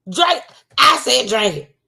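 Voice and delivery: high, pushy mock grandma; shrill-pitched "drink it" command; grandma insisting. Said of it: "she probably was like".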